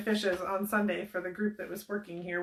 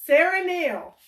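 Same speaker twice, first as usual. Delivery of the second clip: excited announcement; 7 semitones higher, 6 dB louder than usual. Real, not acted. Announcing the winner.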